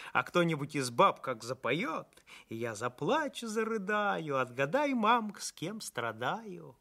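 lilting, swooping countrywoman's storytelling; village baker, dreamy. Acted. Baker tells doctor about holiday feasts, dreamily delighted.

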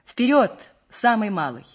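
officer calling through a megaphone; shouted order. A narrator's reading. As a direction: call the order out to the engine room. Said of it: "крикнул в рупор".

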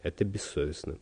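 mild, low-voiced reproach; soft, contained annoyance; cross. A narrator's reading. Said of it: "сказал он сердито". (сердито, но тихо и низко)

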